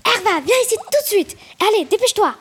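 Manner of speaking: high-pitched, urgent calling; uncle's acted yell. A narrator's reading. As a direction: call the child over urgently. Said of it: "Mon oncle commence à crier".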